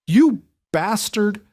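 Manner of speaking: high, slow, furious shriek of a betrayed woman; unhinged shriek. Acted. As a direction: shriek it out like a wronged wife. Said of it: "Nora shrieked, perfectly mimicking the unhinged rage".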